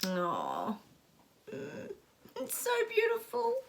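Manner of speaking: choked up, high and fragile; fighting back tears; tearful. Real, not acted. Moved by the anthem, close to crying. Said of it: "Makes me wanna cry".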